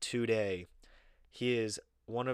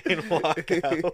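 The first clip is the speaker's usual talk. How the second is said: louder, higher, slow, half-laughing; 8 semitones higher, 11 dB louder, slower than usual. Real, not acted. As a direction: laugh the punchline out. Punchline while laughing.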